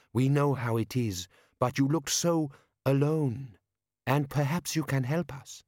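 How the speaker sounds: put-on slow, coaxing girl; lightly voiced girl, reassuring; kindly young woman. Young woman inviting Nobby into the group, gently coaxing.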